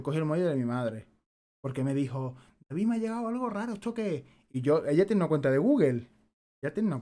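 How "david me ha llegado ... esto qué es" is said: imitating mother. Son imitating mother asking puzzled.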